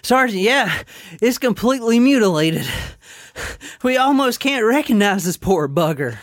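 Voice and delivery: high-pitched winded soldier; out of breath. Acted. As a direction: pant between phrases, acting breathless. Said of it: "they're out of breath".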